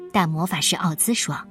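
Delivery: ordinary delivery, nothing marked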